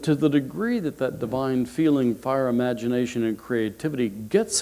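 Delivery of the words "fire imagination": normal talking voice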